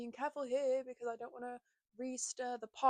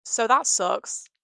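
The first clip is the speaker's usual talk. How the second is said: emphatic; 13 dB louder, much slower than usual. Real, not acted. After painful story.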